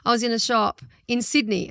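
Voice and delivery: ordinary talk, nothing marked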